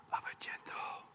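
breathy, voiceless whisper; gentle whisper. Acted. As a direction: let the words fall to a hush. Reading about the gentle whisper.

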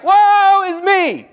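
high, slow, drawn-out lament; Isaiah crying out in despair; despairing prophet. Retold or imitated. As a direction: wail it slowly like a man undone. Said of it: "he's like".

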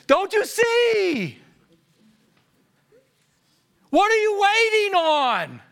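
high, drawn-out exasperated yell; slow, pleading holler at high pitch; inward impatient shout. Acted. Pastor inwardly urging his church on, exasperated yelling. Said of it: "Inwardly, I'm like".

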